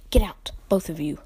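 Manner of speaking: croaked hoarse whisper. A narrator's reading. Shaken uncle croaking at the children to leave. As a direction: rasp it out, voice failing. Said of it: "croaked Uncle Vernon".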